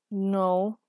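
drowsy, loud "no"; sleepy refusal. A narrator's reading. Sleepy woman refuses breakfast from under the comforter, grumpy.

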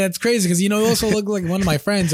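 high, chatty mock small talk; hammed-up clueless guy; mock friendly stranger. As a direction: play the awkward guy who mistakes you for a friend. Mocking a guy bumping into people.

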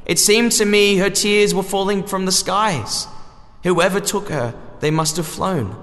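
goddess voice. Acted. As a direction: speak as a solemn goddess. Hecate speaks in the story.

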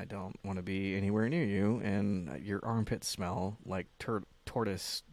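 quiet, low, trailing off; deflated regret. Acted. Man voicing his regret after sex, deflated and quiet. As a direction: let it sink into a soft, deflated mumble.

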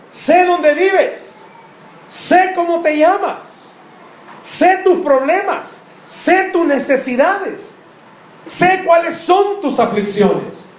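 high, slow, drawn-out divine voice; God listing everything he knows about you, slowly; voicing God. Preacher voicing God to the congregation, high and slow.

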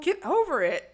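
high, mock dismissive order; insensitive. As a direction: imitate a callous boyfriend brushing it off. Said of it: "He's real insensitive right now".

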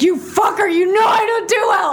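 high, angry yell at a friend. Real, not acted. Scolding his friend for the prank, high yell. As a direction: shout it at him, half laughing, half mad. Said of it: "I'm, like, yelling into the microphone".

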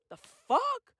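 ordinary delivery

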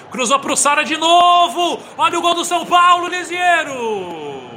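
shouted goal call, stretching names; explosive goal commentary. Acted. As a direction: scream the goal, then draw it out. Goal call by play-by-play narrator.

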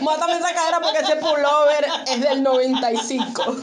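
cracking up while playing the order; laughing mock order. Joking with co-host about whom robbers should shoot, laughing mock order. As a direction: say it through giggles.

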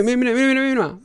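rapid high-pitched repeats; high, insistent retold plea; mock urging. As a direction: rattle it off high, demanding to be watched. Acts himself urging father to watch.

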